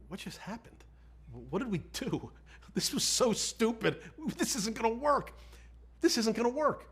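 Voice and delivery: high, frantic replay of his own alarm; retold panic. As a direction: relive the moment of panic, voice climbing. Retold panic after failed debriefing.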